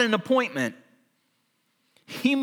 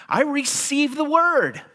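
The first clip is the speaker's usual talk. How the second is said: mock proud boast; 8 semitones higher, 3 dB louder than usual. Climax of boastful list.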